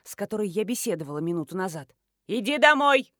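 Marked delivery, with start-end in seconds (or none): 2.3-3.1 loud, higher command; shouted order. A narrator's reading. Mother-in-law orders Nadka home from the window, shouting. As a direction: call her home sharply. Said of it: "закричала из окна соседнего дома тётка".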